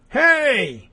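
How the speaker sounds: high, drawn-out mock yell; acted yell. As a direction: call out a long, high "hey". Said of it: "A man yelled at her".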